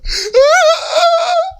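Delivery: a sudden high sob breaking through; the memory catches in the throat and a sob escapes; choked sob. Real, not acted. Pastor recalling the dying woman, a sudden high choked sob.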